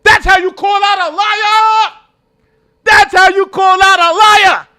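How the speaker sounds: high, slow, full-throated shout; excited shout. Real, not acted. Streamer yelling at the screen in delight, loud shout. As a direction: yell it out in triumph. Cheering the interviewer on.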